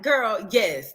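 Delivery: normal talking voice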